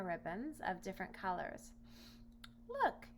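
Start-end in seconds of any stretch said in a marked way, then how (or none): 2.7-3.1 high call for attention; eager look. Acted. Boy showing the lion how to brush, high. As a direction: catch his eye. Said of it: "he said".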